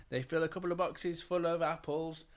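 ordinary delivery

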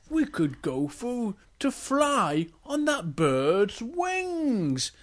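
slow, swooping storybook bear; Big Bear. Acted. Big Bear asks the princess a favour, slow and swooping. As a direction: draw the words out, pitch rising and falling. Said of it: "And Big Bear goes".

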